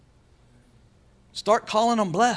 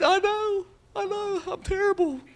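much higher whiny voice; mock self-pity; 13 semitones higher than usual. Preacher voicing a believer agreeing with the devil, high mock whine. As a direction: whimper it high in hammed-up defeat.

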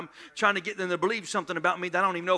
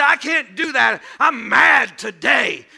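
mock mad whine; 6 semitones higher, 13 dB louder, much slower than usual. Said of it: "You say".

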